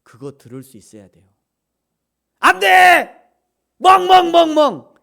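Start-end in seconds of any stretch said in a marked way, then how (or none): 2.4-4.9 high, very loud alarmed "no"; full-volume shouting and loud barking. Shown as a demonstration. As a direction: yell it, then bark like a dog. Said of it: "이래야만 듣는 게 아니고".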